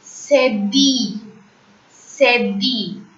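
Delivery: "cedille" as say-DEE, slow and falling; French pronunciation. Shown as a demonstration. Teacher names the fourth accent, slow French "cedille". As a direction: model "cedille" twice, ending in a long ee. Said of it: "I L L E in French is pronounced as E".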